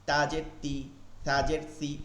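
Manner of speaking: ordinary talk, nothing marked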